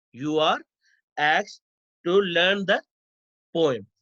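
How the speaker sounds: "You are", "asked", "to learn the", "poem", each followed by a pause; dictation in chunks. Shown as a demonstration. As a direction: break after "are", "asked" and "the" for note-takers. Repeats example in chunks for students.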